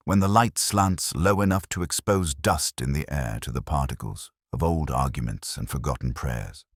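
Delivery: ordinary delivery, nothing marked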